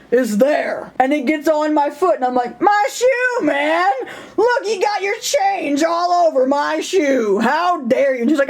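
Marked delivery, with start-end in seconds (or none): 2.6-8.2 much higher, hammed-up indignant yell; over-the-top mock fury; mock outrage. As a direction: play the offended diva, slowly.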